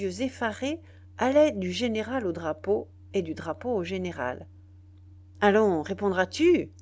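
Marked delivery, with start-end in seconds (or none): 5.4-6.7 louder, impatient prodding. A narrator's reading. General prodding a speechless soldier, impatiently. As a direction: demand an answer, punching it out. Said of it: "dit Biassou avec impatience".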